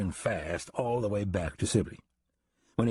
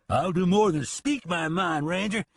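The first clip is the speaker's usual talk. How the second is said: bellowing; 8 semitones higher than usual. Acted. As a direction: roar it at the ranger. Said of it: "he bellowed".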